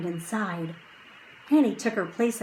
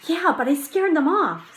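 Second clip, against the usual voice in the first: put-on young boy voice; 8 semitones higher, 5 dB louder than usual. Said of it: "said Jack".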